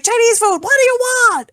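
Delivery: high, loud mock yell down the phone; imitating a yelling phone lady. Retelling the Chinese restaurant lady answering, high mock yell. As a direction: bark it like the angry order-taker. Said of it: "she answered and she went".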